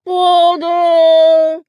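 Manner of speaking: high, loud, hollow cry from inside a jar; muffled Pooh. A narrator's reading. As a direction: call out trapped in a honey jar. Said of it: "he said inside the jar".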